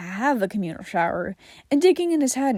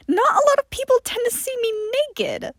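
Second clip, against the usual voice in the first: higher, defensive huff; huffy; 13 semitones higher than usual. Acted. John defending his modesty to Johnny, higher and huffy. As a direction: sound put out and prim. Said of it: "John huffed".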